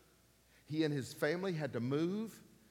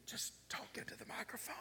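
whisper; 8 semitones higher, 13 dB quieter than usual. Retold or imitated. Retelling, whisper slips in.

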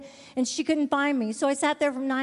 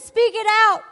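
fervent; 9 semitones higher, 9 dB louder than usual. Acted. Preaching climax, voice raised.

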